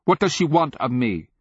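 high, loud, anguished question; cried. A narrator's reading. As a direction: cry it out, pleading for an answer. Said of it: "the Sakarpi king cried".

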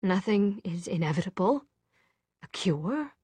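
usual speaking voice

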